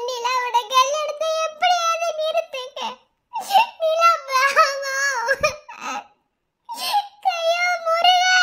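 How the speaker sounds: high, sobbing plea that breaks into a wail; weeping woman wailing for help; tearful pleading. Acted. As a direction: beg through tears, then cry out to Murugan. Woman pleading over Nila's wedding.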